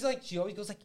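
ordinary talk

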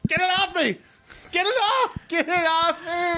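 high shrieking fake panic; mock panic. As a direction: scream like something's on you. Pretends Pidgey attacks him.

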